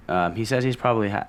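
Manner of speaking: usual speaking voice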